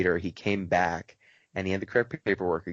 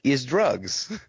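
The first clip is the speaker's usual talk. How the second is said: higher, plain-faced foreign voice; deadpan old man; 6 semitones higher, 4 dB louder than usual. Retold or imitated. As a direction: admit it flatly like an old man. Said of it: "And he's like".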